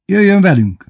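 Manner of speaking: high, loud insistence. A narrator's reading. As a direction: raise your voice to overrule him. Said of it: "emelte fel a hangját Bébi".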